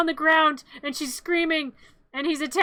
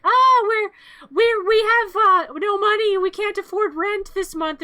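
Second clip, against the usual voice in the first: high, loud pleading plea; desperate high-pitched mother voice; 7 semitones higher, 7 dB louder than usual. Retold or imitated. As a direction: beg high and loud like a broke mom. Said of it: "the woman's like".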